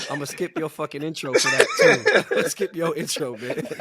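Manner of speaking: loud, high, breaking into laughter; laughing tease. Real, not acted. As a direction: threaten playfully through real laughter. Teasing co-host while cracking up.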